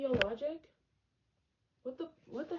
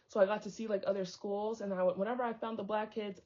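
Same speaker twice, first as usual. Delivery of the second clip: ordinary talk, nothing marked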